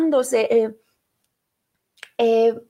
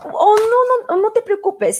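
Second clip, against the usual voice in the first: high, loud burst of "oh no"; sudden protest; 9 semitones higher, 4 dB louder than usual. Real, not acted. Host to a doubting listener, sudden high protest. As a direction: cut the objection off with a surprised yelp.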